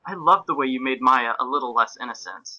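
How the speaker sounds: ordinary talk, nothing marked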